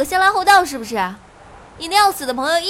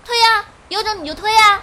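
high defiant yell; shrieking dare; 6 semitones higher than usual. Acted. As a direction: scream the dare back. Tearful dare to push her. (尖声挑衅)

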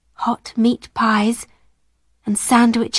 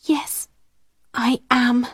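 hushed admission, starting very soft; whispered; 3 dB quieter than usual. A narrator's reading. Sarah admitting her hunger to her friend, half-whispered. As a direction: admit it quietly, close to a whisper. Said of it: "she whispered".

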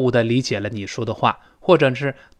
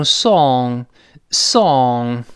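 "song" drawn out with a pouted, rounded British o; British pronunciation; 4 dB louder than usual. Shown as a demonstration. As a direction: push the lips out for a long British "song". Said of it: "英国人会读成song".

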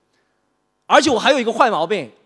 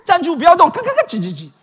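high, loud robber barking orders; hammed-up holdup shout; mock holdup shout; 11 semitones higher, 7 dB louder than usual. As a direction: yell it like a stick-up man. Acted robber holdup scene.

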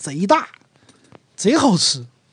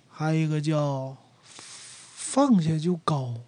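ordinary talk

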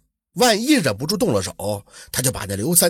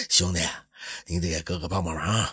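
low, soft chuckle into a coaxing request; chuckle, then ask a favour in a lowered voice; grinning, low-pitched sweet-talk; chuckling wheedle; 10 semitones lower, 7 dB quieter than usual. A narrator's reading. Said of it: "笑嘻嘻地说".

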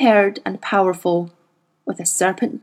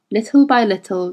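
ordinary talk

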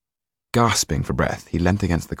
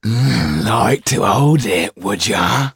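taunting thug; 4 semitones higher, 5 dB louder, much slower than usual. Acted. Thug taunts with glass eye.

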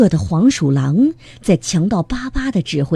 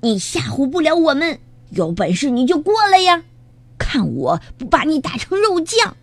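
put-on high, brash young rooster; cocky, high-pitched dare; taunting challenge; 8 semitones higher than usual. A narrator's reading. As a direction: goad the enemy into a fight. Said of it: "大嗓门儿接着叫阵".

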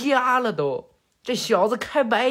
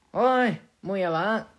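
calling out. Acted. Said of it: "就喊着".

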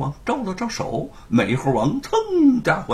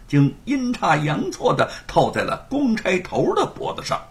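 ordinary delivery, nothing marked

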